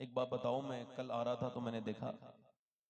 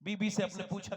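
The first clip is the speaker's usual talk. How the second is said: ordinary delivery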